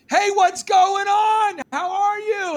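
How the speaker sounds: high, slow, cheery hello; mock friendly greeting. Colonist hails a stranger in a tree, cheerfully.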